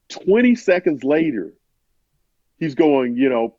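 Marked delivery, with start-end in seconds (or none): none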